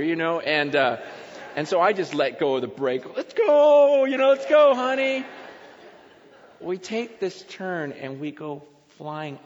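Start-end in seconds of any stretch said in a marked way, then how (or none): 3.1-5.3 high, loud ride yell; excited urging. Retold or imitated. Dad racing downhill with his daughter, excited high shout. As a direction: yell it out as you let the brake go.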